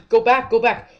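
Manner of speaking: loud, urgent pleading; real panic, shouting it out; scared gamer yelling at the screen; panicked shout. Real, not acted. Panicking after jump scare.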